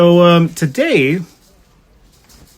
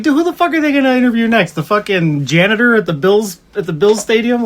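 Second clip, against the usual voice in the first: fast high incredulous rant; exasperated rant; 7 semitones higher than usual. Retold or imitated. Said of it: "I'm like".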